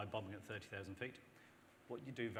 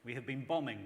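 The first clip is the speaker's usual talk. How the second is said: normal talking voice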